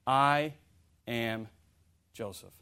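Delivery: slow, low, fading word by word; hushed, spaced-out reveal; not yelled. Acted. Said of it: "I don't think he yelled it".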